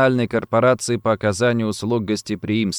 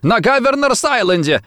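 high, panicked blurt; frightened cry; 10 semitones higher, 3 dB louder than usual. A narrator's reading. Rossetti confesses from the floor, crying out frightened. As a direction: give it up in terror, pitch way up. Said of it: "закричал он испуганно".

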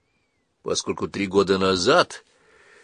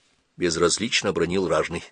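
usual speaking voice

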